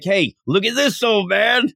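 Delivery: high teasing call; taunting girl voice. Retold or imitated. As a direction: tease the old man. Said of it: "She's like".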